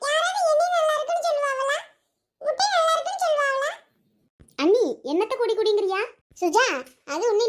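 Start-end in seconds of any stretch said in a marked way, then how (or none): 0.1-3.7 put-on whining girl. Girl protesting the egg drink.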